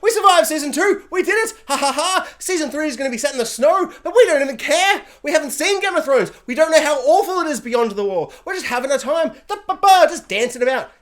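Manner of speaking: high, loud, giddy mock cheer; giddy celebrating. The cast celebrating surviving season two, giddy. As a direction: gloat like a kid who got away with it. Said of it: "being like".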